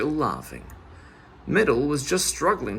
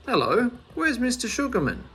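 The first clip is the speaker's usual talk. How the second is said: Father Jenks asking; 9 semitones higher than usual. A narrator's reading. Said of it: "he asked".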